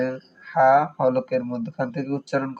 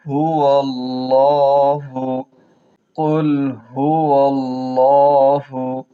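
slow melodic tajweed chant; Quran recitation; 5 dB louder, much slower than usual. Shown as a demonstration. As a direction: intone it like a Quran teacher. Recitation demo for students.

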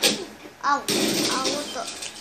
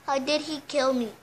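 usual speaking voice

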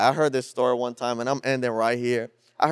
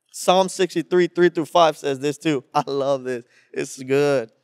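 ordinary talk, nothing marked

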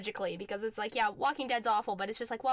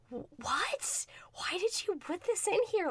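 bewildered whisper; 8 semitones higher than usual. Retold or imitated. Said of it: "where you're just like".

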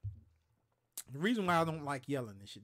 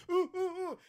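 dazed grunts; 4 dB louder than usual. Retold or imitated. Knocked-out coworker dazed.

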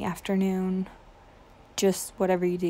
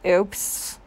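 ordinary delivery

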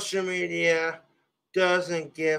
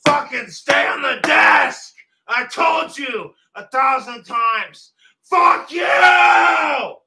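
much higher, cracking furious screams; crying rage; 6 semitones higher, 4 dB louder than usual. Real, not acted. Streamer screaming at objects that won't behave, crying rage. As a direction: scream it out on the edge of tears.

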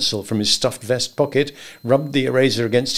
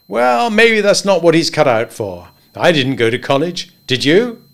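higher, louder, bluff and jovial; higher voiced good old boy; hearty Henry; 5 semitones higher, 5 dB louder than usual. A narrator's reading. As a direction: boom friendly advice across the desk. Henry chatting.

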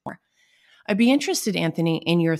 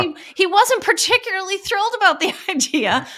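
laughing through the words, pitched up; giggling while telling it; 5 dB louder than usual. Real, not acted. Joking about reluctant podcast guest.

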